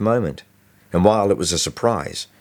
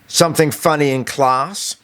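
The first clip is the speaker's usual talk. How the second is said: higher, sneering school bully taunt; a lightly voiced bully, taunting; 7 semitones higher than usual. A narrator's reading. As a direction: sneer the question at the small kid. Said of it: "big, sneering Blocker Blukner asked".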